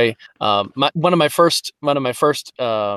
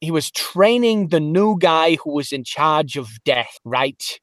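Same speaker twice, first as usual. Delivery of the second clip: streetwise New Jersey trainer's voice; New Jersey accent. Acted. New Jersey boatman training the new guy in charge of death, New Jersey accent. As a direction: talk like a New Jersey guy showing the ropes. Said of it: "a New Jersey. Uh, he was".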